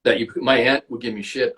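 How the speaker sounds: normal talking voice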